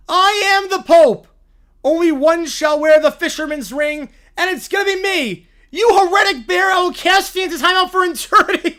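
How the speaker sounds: put-on loud, high bratty child proclaiming; hammed-up child pope decree; a kid yelling his holy decree; Caillou as a mock Pope.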